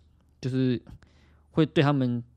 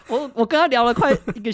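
high, giggling through the answer; laughing; 13 semitones higher, 5 dB louder than usual. Real, not acted. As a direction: caught out and laughing it off. Teased about the long call.

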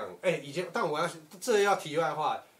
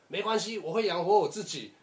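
louder, higher defiant declaration; defiant farewell; 5 semitones higher, 5 dB louder than usual. Retold or imitated. Teen storming out, shouting back defiantly at his mother. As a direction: fling it back at mom as you go. Defiantly telling mom he leaves.

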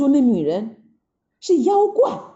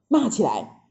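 ordinary delivery, nothing marked

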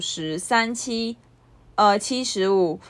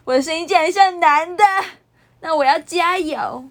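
resolving to sound higher; 8 semitones higher, 4 dB louder than usual. Retold or imitated. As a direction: push the pitch up to sound less like a boy. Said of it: "我当时就想说". (刻意提高音调、慢慢地下定决心)

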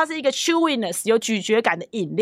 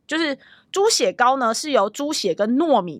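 normal talking voice

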